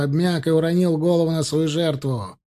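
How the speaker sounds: ordinary delivery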